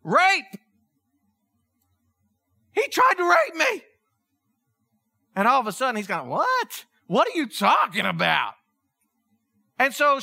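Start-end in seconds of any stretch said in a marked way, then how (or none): 0.0-3.8 high, loud cry of accusation; mock scream. Potiphar's wife accusing Joseph as he runs out, high imitated scream. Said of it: "she starts screaming". 6.3-8.5 mock disbelief, pitch leaping up at first; incredulous. Acts out Joseph's shocked reply.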